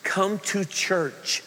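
normal talking voice

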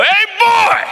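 high, loud holler for a son; imitating dad's shout; dad calling out. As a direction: holler it like a father summoning kids. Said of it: "he would just go".

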